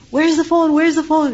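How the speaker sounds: half-playful where's-the-phone call; frantic calling. Retold or imitated. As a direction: lightly act someone hunting for a phone. Someone searching, calling out.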